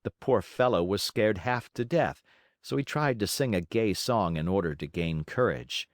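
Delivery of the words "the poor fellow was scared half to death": ordinary delivery, nothing marked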